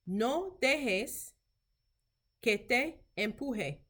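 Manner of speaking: measured phrases with a pause midway; slow language model. Shown as a demonstration. Teacher modelling Spanish for clinicians, slow and careful. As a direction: say it slowly for learners to repeat.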